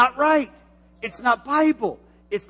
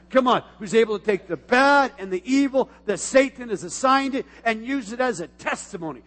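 raised, slow emphatic declaration; preaching; 3 semitones higher, 6 dB louder than usual. Acted. Pastor declaring God's goodness to the church, preaching. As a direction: declare it with full force.

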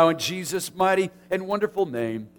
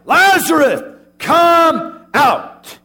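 loud, high commanding cry; cried out; 10 semitones higher, 9 dB louder, much slower than usual. Shown as a demonstration. Pastor shows Jesus crying out to Lazarus, loud and high. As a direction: call him out of the tomb at full voice. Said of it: "No, Jesus cried out".

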